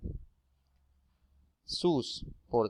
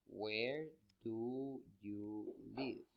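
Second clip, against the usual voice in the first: slow, soft, each word of Where do you live stretched; slow quiet English model; 12 dB quieter, much slower than usual. Shown as a demonstration. Teacher reading the English example to the class, slow and soft. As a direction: read it like a dictation. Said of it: "Otros ejemplos serían estos".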